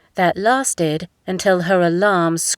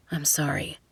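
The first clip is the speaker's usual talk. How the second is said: whispered, tender apology; soft whisper; 3 semitones lower, 8 dB quieter than usual. A narrator's reading. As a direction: breathe the apology softly to him. Tender apology after the fight.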